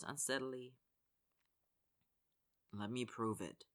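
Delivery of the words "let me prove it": soft, quiet plea; pleading. A narrator's reading. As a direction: beg gently, low and close. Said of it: "Connor pleads".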